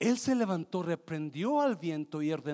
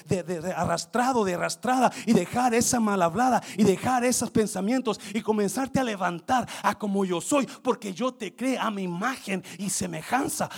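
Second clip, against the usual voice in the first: louder fervent preaching; rising preaching; 6 semitones higher, 11 dB louder than usual. Acted. Preacher voicing God's call, louder preaching. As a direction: build the congregation up.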